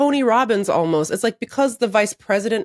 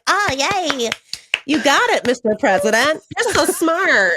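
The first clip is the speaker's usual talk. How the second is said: higher, gushing mock praise with a laugh in it; mock fawning aides; 7 semitones higher than usual. Podcaster mocking aides praising the president, gushing mock flattery. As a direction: play the sycophants cooing over the boss.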